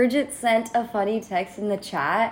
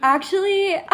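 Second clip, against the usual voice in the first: high mock reveal; 10 semitones higher, 4 dB louder than usual. Mocking friend's reveal.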